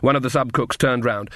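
plain reading voice

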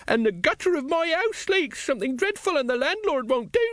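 put-on very high old woman's grumble; complaining old woman. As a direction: pipe a high-pitched litany of complaint. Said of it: "said an old woman next to him".

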